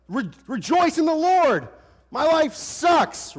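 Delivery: high, loud mock lament of David; mock psalmist. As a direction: ham up a whining psalm singer. David's psalm voiced mockingly.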